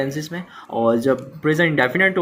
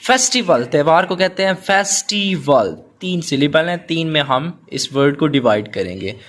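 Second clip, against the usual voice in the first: usual speaking voice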